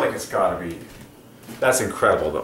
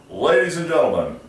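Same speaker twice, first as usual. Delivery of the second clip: booming arena announcer, stretched out; games announcer; 8 semitones higher, 5 dB louder than usual. Pretend. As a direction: hype up the home crowd. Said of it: "I would be the best games announcer".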